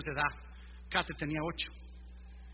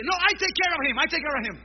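high, loud eager offer; hammed-up eager helper grabbing the bags; 10 semitones higher, 7 dB louder than usual. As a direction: jump in keen to help. Airport crowd eagerly offering help.

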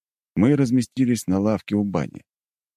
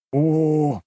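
pleased; 11 semitones higher than usual. Acted. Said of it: "довольно сказал ушастый".

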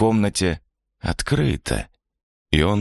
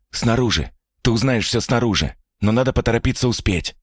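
higher, pressing urgency; urgent; 7 semitones higher than usual. Acted. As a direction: push him to hurry. Stranger pulls him urgently.

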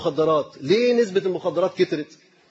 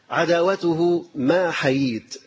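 slow emphatic; 3 semitones lower, much slower than usual. Shown as a demonstration.

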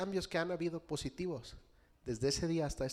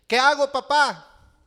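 much higher, loud, slow puzzled question; puzzled son; 11 semitones higher, 16 dB louder, slower than usual. Retold or imitated. Son asks his father what to do, high, loud and slow. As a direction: drag it out, baffled kid.

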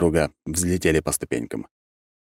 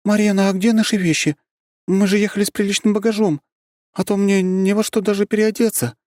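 high-pitched, raised female character voice; loud questioning; 4 dB louder than usual. A narrator's reading. Зельда asks Марьяна about luggage, loudly demanding. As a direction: call out the complaint, high and insistent. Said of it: "вдруг остановилась и громко спросила".

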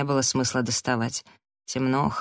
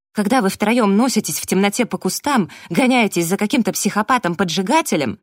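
raised, higher, heated tirade; angry scolding; 5 semitones higher, 6 dB louder than usual. A narrator's reading. Abby berating Daniel for losing control, heated scolding. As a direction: burst out and lay into him.

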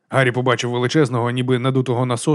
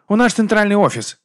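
higher-pitched metallic phone voice; 8 semitones higher, 3 dB louder than usual. A narrator's reading. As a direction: answer like a metallic voice on the line. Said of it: "відповів металевий голос".

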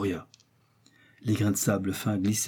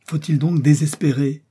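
higher, louder anguished exclamation; the doctor cries out in despair at the sky; despairing cry; 6 semitones higher, 5 dB louder than usual. A narrator's reading. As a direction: cry out as hope slips away. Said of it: "s'écria-t-il".

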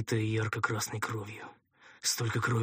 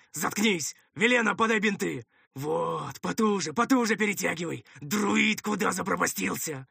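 higher, urgent string of orders; raised, tense ordering voice; brusque commander; 9 semitones higher, 4 dB louder than usual. Acted. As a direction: take charge impatiently of the bandaging. Commander orders during treatment.

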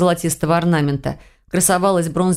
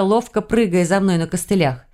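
usual speaking voice

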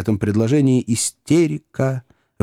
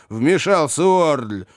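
usual speaking voice

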